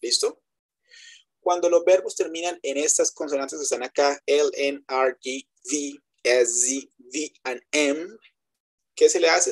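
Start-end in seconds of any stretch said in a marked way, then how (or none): none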